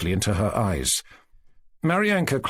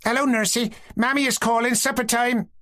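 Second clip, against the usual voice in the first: put-on little boy shouting; 13 semitones higher than usual. A narrator's reading. Little brother calling his sister in for supper, high child's shout. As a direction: call her home like a small boy. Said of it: "shouted Maryanka's little brother".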